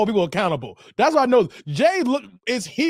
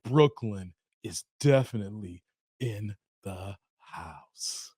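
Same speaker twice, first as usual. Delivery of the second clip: very low, soft, measured drawl; movie character imitation; 13 semitones lower, 8 dB quieter, slower than usual. Host imitating a He Got Game line, deep hushed imitation. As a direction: sink deep and trail into quiet. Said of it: "when he was like".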